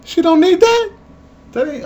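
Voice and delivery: high, loud disbelieving yell; shocked outburst. Retold or imitated. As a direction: shriek it in horror. Shocked dad yelling.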